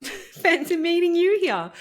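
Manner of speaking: amused, laughing hello; laughing greeting. Real, not acted. Greeting her perfectionism, amused.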